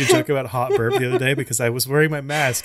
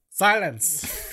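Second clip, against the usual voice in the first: "Silence" pitched high, then falling; high command; 5 semitones higher than usual. Pretend. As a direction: start "Silence" high and let it fall. Two takes of one command.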